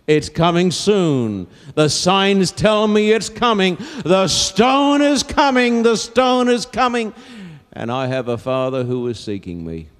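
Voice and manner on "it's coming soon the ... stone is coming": impassioned preaching. Real, not acted. Preacher announcing a new world coming, impassioned preaching. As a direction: proclaim it with mounting urgency.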